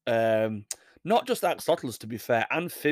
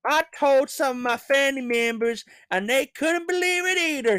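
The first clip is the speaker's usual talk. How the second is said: much higher put-on American accent; lightly hammed-up American winner; 12 semitones higher, 3 dB louder than usual. Quoting the winner's family news in a mock American accent. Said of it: "she said".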